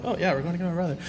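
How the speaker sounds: excited retelling. Retold or imitated. Telling of the car ride to fetch brother, excited. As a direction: play back a kid's mild thrill. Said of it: "it was, like, really exciting".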